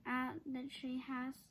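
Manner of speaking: ordinary delivery, nothing marked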